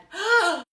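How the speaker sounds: loud, high astonished gasp; storyteller gasps in wonder; big gasp. Acted. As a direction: gasp in amazement at the egg. Hens amazed at the egg.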